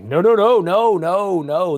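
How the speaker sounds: loud rising protest of no's that winds down; mock-panicked loud refusal; emphatic refusal. Refusing pastors' request to preach.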